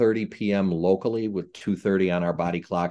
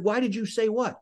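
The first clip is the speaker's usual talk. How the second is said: higher, baffled counter-question; puzzled reply; 13 semitones higher than usual. Retold or imitated. Referee to his umpire, high baffled question. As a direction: toss it back, no clue what he means. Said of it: "I said".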